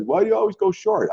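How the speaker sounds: high, whiny mock of his critics; mocking question. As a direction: parrot the doubters in a needling pitch. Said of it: "everyone's like".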